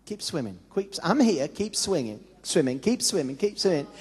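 soft, coaxing father voice; a father quietly urging his son to keep swimming; gentle encouragement. Retold or imitated. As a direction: coax the child on softly. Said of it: "he was saying".

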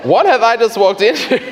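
high, swooping, stunned whoa; startled mock reaction. Newcomer reacting to her fierce prayer, comically startled. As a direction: play up being taken aback.

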